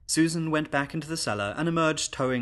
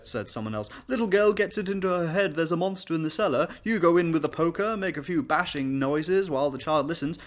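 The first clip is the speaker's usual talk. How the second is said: pompous gentleman; 7 semitones higher, 5 dB louder than usual. Acted. Guest explains.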